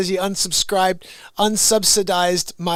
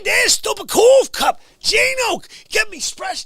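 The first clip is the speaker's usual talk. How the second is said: loud, high indignant shouting; angry rant; 11 semitones higher, 4 dB louder than usual. Real, not acted. Scolding the chat troll, loud and high with outrage. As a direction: blast him with outrage.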